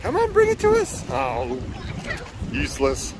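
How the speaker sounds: ordinary talk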